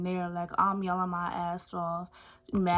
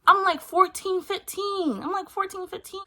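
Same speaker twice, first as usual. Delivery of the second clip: indignant; 10 semitones higher, 3 dB louder than usual. Real, not acted. Stressing how young she was.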